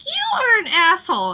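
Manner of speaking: teasing jab; Kaede insulting. Retold or imitated. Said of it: "she'll be like".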